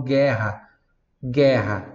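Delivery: guerra as "GHEH-ha", U left silent; hard G straight into open E on guerra; Portuguese pronunciation. Shown as a demonstration. Teacher shows the Portuguese word, guerra without any U sound. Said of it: "em português eu falo guerra".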